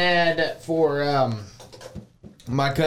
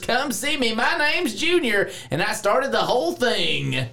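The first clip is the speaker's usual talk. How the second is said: higher, hammed-up Southern dealer yelling his pitch; a small-town dealer bellowing at viewers to come buy trucks; shouted country ad; 7 semitones higher than usual. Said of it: "You gonna use your country accent?".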